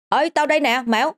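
put-on high boy's voice calling back; lightly voiced boy calling back; answering call. A narrator's reading. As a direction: answer a friend's call from afar.

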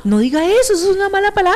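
high, loud scold of a prim adult; mock scolding. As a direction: play a shocked scold over a rude word. Imitating someone scolding a word.

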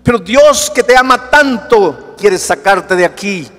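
high, loud impassioned sermon; preaching at full force. Acted. Pastor urging a hurting listener to accept Jesus, fervent preaching. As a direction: preach it out, rising to the rafters.

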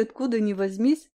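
usual speaking voice

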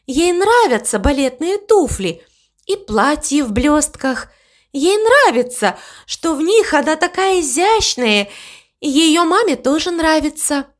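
high, lilting voice of an enthusiastic cow; dreamy cow. Acted. Cow telling the crow about the girl dancer, dreamy delight.

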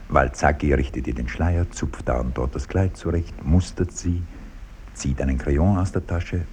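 normal talking voice

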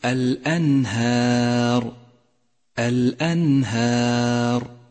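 slow recited الأنهار, long thin ا after ه; light alif. Shown as a demonstration. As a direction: stretch the last ا of الأنهار, keeping it light. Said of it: "رققت الألف". (ترتيل الأنهار مع ترقيق الألف)

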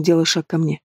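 ordinary delivery, nothing marked

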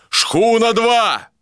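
high, drawn-out calling shout; long-distance hail. A narrator's reading. Captain hails the second schooner, drawn-out high shout. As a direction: call slowly across the water. Hailing another schooner through snow.

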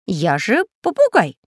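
high, loud parrot cry; shouting parrot. Acted. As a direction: squawk it out eagerly. Parrot answers.

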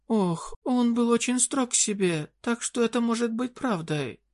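high desperate queen voice; pleading fairy queen. Acted.

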